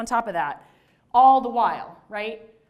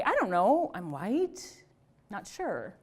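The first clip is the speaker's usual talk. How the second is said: mock unsure, questioning rise; playing ignorant; 2 semitones lower, slower than usual. Student asked their race, playing ignorant. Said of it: "they would play ignorant or they would get uncomfortable".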